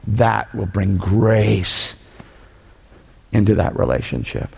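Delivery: very slow, low and gentle; tender and slow. Real, not acted. Preacher closing on grace, slow and tender. Tender close after apology.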